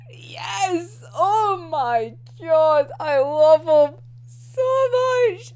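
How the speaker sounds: slow, high, drawn-out adoration; gush, stretching every word; gushing fangirl joy. Real, not acted. Gushing over favourite character's reveal.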